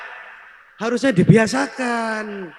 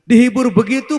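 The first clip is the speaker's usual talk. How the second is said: usual speaking voice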